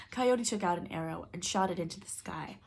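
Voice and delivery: plain reading voice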